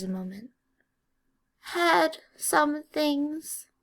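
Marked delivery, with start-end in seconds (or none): 1.8-3.7 high, slow faltering elf voice; nervous elf pause; hesitant elf. A narrator's reading. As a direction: falter mid-sentence as the elf. Elf confessing.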